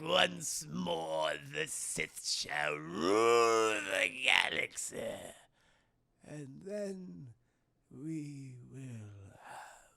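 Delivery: low, slow, fading to a breathy hush; slow sinister emperor voice sinking to a whisper; Palpatine impression. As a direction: drag every word out like a creepy old emperor. Palpatine quote voiced in recap.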